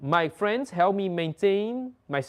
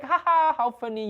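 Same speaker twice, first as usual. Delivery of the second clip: teasing; 10 semitones higher, 3 dB louder than usual. Retold or imitated. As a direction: tease him for playing dumb. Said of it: "They're like".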